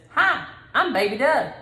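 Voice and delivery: put-on high, loud little-kid introduction; little boy voice. Grandma voicing the toddler's proud hello. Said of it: "and he says".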